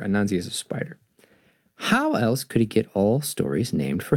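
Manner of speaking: lively read-aloud storyteller voice; animated picture-book narration; story narration. A narrator's reading. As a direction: tell it like reading to kids at bedtime. Reads the children's story aloud.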